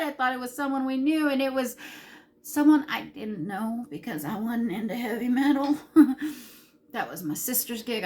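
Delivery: normal talking voice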